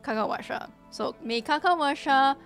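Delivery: ordinary delivery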